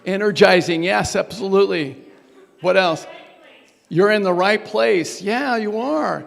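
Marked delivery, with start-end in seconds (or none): none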